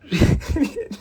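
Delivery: high laugh breaking out; a real laugh that bursts out; burst of laughter. Real, not acted. Retelling the dating story, he bursts out laughing. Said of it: "I can't even say it without laughing".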